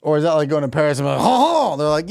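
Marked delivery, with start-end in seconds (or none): 1.2-1.8 high silly fake laugh; mock tourist laugh. Mock French laugh in Paris.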